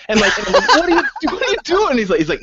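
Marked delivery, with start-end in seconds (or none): 0.7-2.0 high, laughing what are you doing; real amused disbelief. Retold or imitated. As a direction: ask in stunned laughter, high. GM shocked at player.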